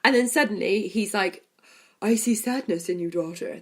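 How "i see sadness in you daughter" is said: lightly voiced Thanos, sinking softer at the end; a hint of brooding Thanos grief; Thanos impression. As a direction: Thanos speaking to his daughter, lightly imitated. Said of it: "That's my Thanos".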